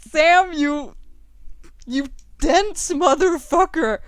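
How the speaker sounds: loud, slow, furious yell at the screen; exasperated yell. Real, not acted. Gamer yelling at her character's dumb choice, furious.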